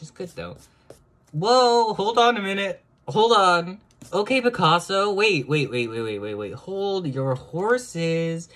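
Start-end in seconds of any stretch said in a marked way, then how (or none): 1.3-5.1 high, very loud squealing surprise; excited shriek. Real, not acted. Amazed at her own drawing, high squealing shriek. 5.1-8.5 loud, high rapid waits; excited. Real, not acted. Stunned by her drawing, loud giddy disbelief.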